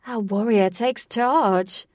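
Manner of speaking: slow, loud, grand announcement; theatrical announcer. Acted. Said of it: "Jose announced theatrically".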